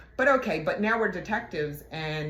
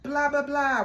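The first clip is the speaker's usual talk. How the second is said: higher-pitched mock holler; imitated yell across an office; retold yell; 10 semitones higher, 4 dB louder, slower than usual. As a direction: call out like hailing a coworker, imitating him. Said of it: "he yelled".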